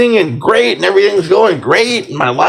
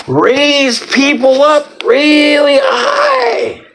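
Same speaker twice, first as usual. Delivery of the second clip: pitched way up and drawn out slowly; raised high; 9 semitones higher, much slower than usual. Shown as a demonstration. Urging the loosh farmers, high and slow. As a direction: lift "high" up and hold it slow. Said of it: "stretched high".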